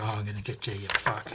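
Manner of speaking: low, quiet threat; dropped low and soft; mock menace. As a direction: play a villain threatening under his breath. Villain's threat, acted.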